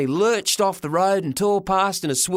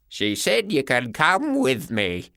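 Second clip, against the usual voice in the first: put-on slow old grandpa voice; a gentle old man, slowly; 2 semitones lower, slower than usual. A narrator's reading.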